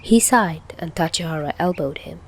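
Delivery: plain reading voice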